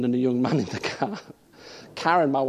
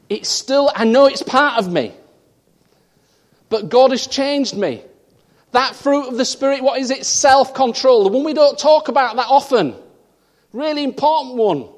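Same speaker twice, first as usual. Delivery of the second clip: loud, higher preaching on self-control; full-force preaching; 9 semitones higher, 11 dB louder than usual. Acted. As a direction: proclaim it to the whole church.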